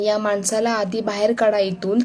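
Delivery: ordinary delivery, nothing marked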